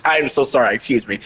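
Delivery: ordinary delivery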